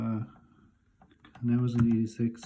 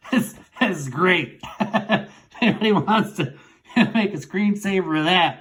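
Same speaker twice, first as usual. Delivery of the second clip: real laughter breaking through the words, higher; laughing; 8 semitones higher, 6 dB louder than usual. Real, not acted. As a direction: laugh while you talk about the photo. Laughing at his childhood photo.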